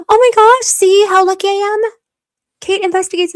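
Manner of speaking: gasping, high and loud delight. Real, not acted. Streamer gasping at a viewer's comment, thrilled. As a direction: gasp then squeal with joy.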